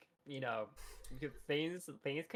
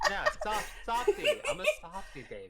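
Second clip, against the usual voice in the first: squeaky high; 5 dB louder than usual. Pretend. Squeaky softy joke.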